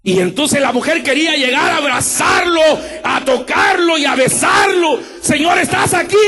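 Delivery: high, shouted sermon narration; preacher to congregation, shouting the reunion; fervent preaching. Real, not acted. Let it soar, full revival fervor.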